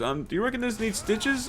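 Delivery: high, hesitant voice of the nervous trainee doctor; unsure trainee doctor. Retold or imitated. Trainee doctor unsure, asking the passing nurse, high timid question.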